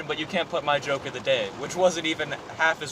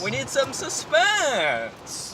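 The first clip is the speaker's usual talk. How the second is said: slow, high suspense voice; suspenseful announcer voice; 9 semitones higher, much slower than usual. Pretend. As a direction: stretch it out for suspense. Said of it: "I talk like this".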